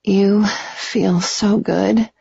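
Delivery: high, slow, drawn-out pleasure; shameless moan. A narrator's reading. Woman to her lover, moaning shamelessly. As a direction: moan it slowly, savouring each word. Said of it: "I moaned shamelessly".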